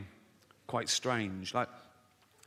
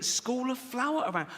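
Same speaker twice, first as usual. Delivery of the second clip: incredulous; 9 semitones higher than usual. Real, not acted. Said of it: "I couldn't believe it".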